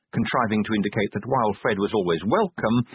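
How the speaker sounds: plain reading voice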